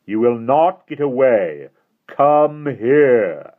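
slow, loud, high menacing call. Acted. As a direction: call them over, slow and commanding. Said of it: "the deep voice called to them again".

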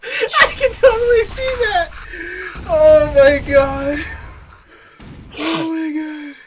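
high, squeaky helpless laughter-talk; real crying-with-laughter voice; laughing to tears. Real, not acted. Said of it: "I'm crying".

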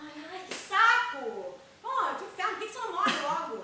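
furious shout, high and loud; yelled, fed-up cursing; angry outburst. Acted. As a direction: explode at your mother in frustration. Daughter explodes at mother.

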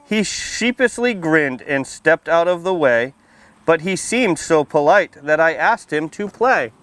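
put-on lively storybook read-aloud for little kids; reading to children. A narrator's reading. Firefighter reading a picture book to kids at story time, lively read-aloud. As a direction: read it out bright and clear for the children.

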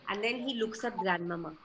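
ordinary delivery, nothing marked

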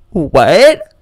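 high, swooping imitated "what?"; mock disbelief. Contestant told she has a bad attitude, mock disbelief. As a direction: act out an affronted, rising double take. Contestant shocked by judges' criticism.

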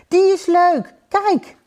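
put-on high, loud excited child; enthusiastic little boy. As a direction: call out in a small boy's delight. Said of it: "Enthousiast wees hij". (enthousiast jongetje, hoog en luid)

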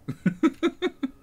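high-pitched staccato laugh; high cackling chuckle at own Russian bit. Pretend.

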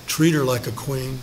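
ordinary talk

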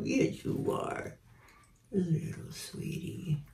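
slow, soft whisper to the bird. Real, not acted. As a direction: slowly whisper tenderness. Cooing to pet bird.

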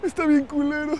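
high, whiny complaint breaking into laughter; mock weeping disappointment. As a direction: whine as if let down by the surprise. Mock-weeping disappointment at first sight of sea. (queja aguda, como llorando)

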